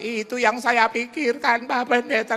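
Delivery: put-on high, loud, tearful old lady; wailing like the crying widow on the phone. Widow weeping to the pastor, high and tearful.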